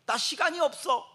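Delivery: imitated harried brush-off; mock busy person. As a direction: toss it off impatiently. Quoting busy person again.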